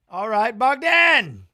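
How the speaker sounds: loud, drawn-out cheer; slow and loud, hyping him up; egging on the fighter. Real, not acted.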